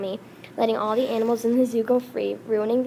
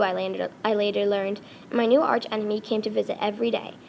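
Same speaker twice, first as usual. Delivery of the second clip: ordinary delivery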